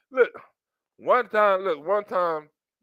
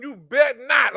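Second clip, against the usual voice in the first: higher, louder sassy warning; sassy threat; 6 semitones higher, 4 dB louder than usual. Retold or imitated. Woman warning again, sassy. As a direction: clip it like a threat.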